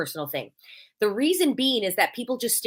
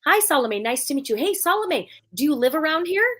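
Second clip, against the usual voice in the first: bright, higher, friendly hello; friendly greeting demo; 7 semitones higher than usual. Shown as a demonstration. Demoing how she greets a new contact, upbeat and higher. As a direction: greet them warmly by name, pitched up. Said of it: "I say their name three times".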